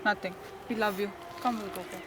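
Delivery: mother softly soothing her crying son; soft comforting. Acted.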